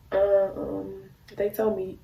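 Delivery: ordinary delivery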